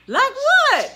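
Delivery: high, loud innocent "like what?"; innocent surprise. Retold or imitated. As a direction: play the oblivious student. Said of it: "She's like".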